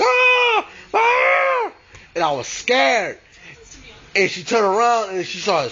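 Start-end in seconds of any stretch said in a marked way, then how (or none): 0.0-1.7 horrified scream. Retold or imitated. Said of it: "I was like".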